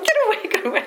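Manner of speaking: high-pitched mock panic, breaking into laughter; loud, high imitation of a frightened sister; imitated panicked cry. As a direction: squeal it like someone fending off a dog, laughing. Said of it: "like this".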